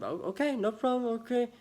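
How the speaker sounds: high, light little voice imitating a worker; mimicked agreeable worker. Shop worker agreeing to a customer's request, mimicked agreeable tone.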